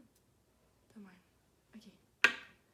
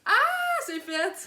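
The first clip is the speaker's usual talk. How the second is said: high, loud cry of relief; excited shout; 14 semitones higher, 15 dB louder, much slower than usual. Real, not acted. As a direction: shout it out in triumph. Celebrating the finished injection.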